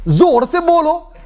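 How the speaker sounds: high, slow, hammered-out demand; mock rallying shout in Urdu; goading the crowd. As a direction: imitate a fired-up speaker scolding his audience. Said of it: "the guy had a go at them".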